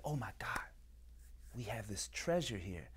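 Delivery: hushed, breath-only amazement; awed whisper. Acted. Seeing a youth walk into the masjid, awed whisper. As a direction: whisper it as if spotting treasure. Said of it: "you need to look at them like".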